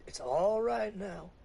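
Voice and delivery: slow, gentle reassurance; comforting. Acted. Preacher comforting the sobbing woman, slow and gentle. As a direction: soothe her slowly.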